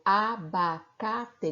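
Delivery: "abacate" very slow, syllable by syllable; sounded out slowly. Shown as a demonstration. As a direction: stretch "abacate" into a, ba, ca, te. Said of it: "o som da letra A". ("abacate" bem devagar, separado em sílabas)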